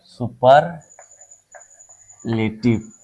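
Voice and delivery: Superlative split as "super", long pause, "लेटिव"; slow, broken-up word. Shown as a demonstration. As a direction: say superlative very slowly, in two separate chunks. Writing out the term for pupils. (Superlative को बीच में रुककर, धीरे-धीरे बोलें)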